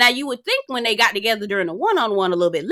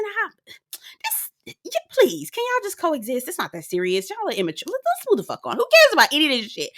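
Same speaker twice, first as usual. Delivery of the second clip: high exasperated pleading; exasperated plea; 7 semitones higher than usual. Retold or imitated. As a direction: beg them to just stop. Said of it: "I said".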